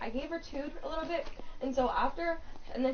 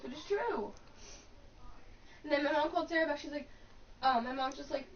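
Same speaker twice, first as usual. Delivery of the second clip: normal talking voice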